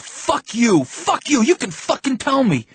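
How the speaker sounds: high-pitched, loud outburst; enraged, voice shooting up high; furious yell. A narrator's reading. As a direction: yell it at the top of your voice. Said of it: "I yelled".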